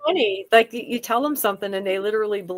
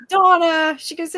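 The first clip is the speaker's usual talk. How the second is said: loud, higher exasperated mom; mom's disbelieving retort; 7 semitones higher, 4 dB louder than usual. Retold or imitated. Mom answering her child's chocolate milk claim, exasperated. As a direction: call out the name like an exasperated mom.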